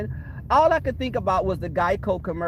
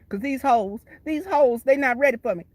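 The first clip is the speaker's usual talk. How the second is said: higher, sassy bragging voice; Tracy parody; 7 semitones higher than usual. Retold or imitated. Parody of Tracy boasting to herself, sassy and high. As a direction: brag like you own the room. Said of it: "how Tracy be talking in third person to herself".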